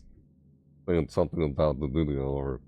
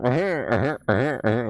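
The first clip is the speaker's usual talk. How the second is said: laughing; 8 semitones higher, 3 dB louder than usual. Real, not acted. Laughs after impression.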